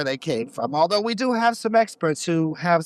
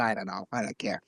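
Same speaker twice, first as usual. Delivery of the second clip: dismissive mutter; 9 semitones lower, 9 dB quieter, faster than usual. Acted. Dismissive aside about cairns.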